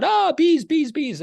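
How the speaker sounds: high yelped bees, mimicking a panicked wife; wife squealing about the bee swarm, mock panic. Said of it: "My wife is freaking out".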